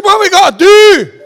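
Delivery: very high, loud Scottish holler; Scottish accent. Retold or imitated. Junkie answering the preacher, high shouted Scottish accent. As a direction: shriek it as an impersonated Scottish junkie. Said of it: "In a Scottish accent".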